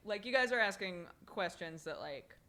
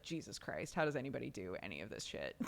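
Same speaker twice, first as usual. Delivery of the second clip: exasperated mutter; 7 semitones lower, 6 dB quieter, faster than usual. Retold or imitated. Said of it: "I'm still just like".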